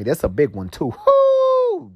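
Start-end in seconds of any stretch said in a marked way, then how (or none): 1.1-1.9 high joyful whoop. Real, not acted. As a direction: let out a big excited whoo. Exuberant whoop mid story.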